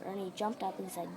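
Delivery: plain reading voice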